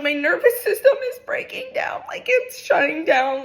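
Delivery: high-pitched, hammed-up alarm; retold panic. Telling dad her body is failing, mock panicked cry. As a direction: reenact a panicked wail, pitch shooting up.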